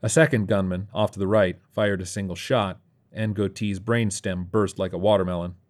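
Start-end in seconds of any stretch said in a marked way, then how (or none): none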